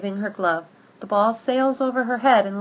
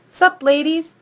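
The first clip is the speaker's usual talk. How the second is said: cocky greeting; 8 semitones higher, 7 dB louder than usual. Acted. Dog greets friends cockily.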